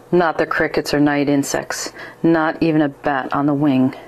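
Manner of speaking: ordinary delivery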